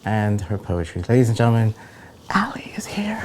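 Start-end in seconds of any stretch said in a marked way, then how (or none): 2.3-3.2 breathy hushed whisper. Acted. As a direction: whisper the reveal like a secret. Same announcement, now whispered.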